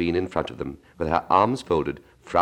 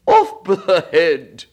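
higher, louder, slow royal roar; Queen shouting; 11 semitones higher, 8 dB louder, much slower than usual. Acted. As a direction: bark the sentence at the player.

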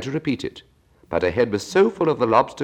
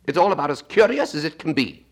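high Gryphon voice; 11 semitones higher, 4 dB louder than usual. Acted.

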